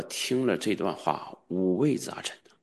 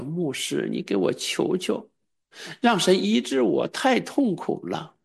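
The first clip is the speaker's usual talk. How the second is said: pleading, slowing right down at the end; imitating a sick man begging through tears; retold crying plea; 10 semitones higher, slower than usual. Sick brother begging the pastor for healing, mock sob. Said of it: "哭着跟我说".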